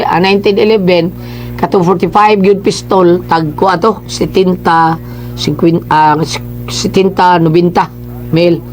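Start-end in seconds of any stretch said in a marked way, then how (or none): none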